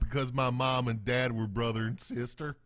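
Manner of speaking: hammed-up hillbilly accent, slowing at the end; broad put-on hillbilly twang; mock hillbilly drawl. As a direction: play a dim hillbilly explaining himself. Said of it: "I think hillbilly-ish drawl is".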